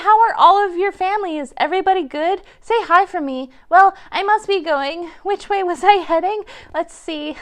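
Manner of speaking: ordinary talk, nothing marked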